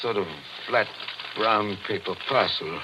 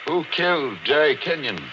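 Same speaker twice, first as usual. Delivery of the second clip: calling out; 8 semitones higher than usual. Acted. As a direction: shout at the record to answer. Calling out at the phonograph.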